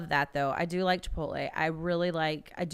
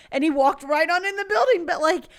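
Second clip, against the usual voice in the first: much higher, louder amused disbelief; laughing disbelief; 7 semitones higher, 6 dB louder than usual. Real, not acted. Teacher marvelling at the kid, laughing. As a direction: laugh in wonder.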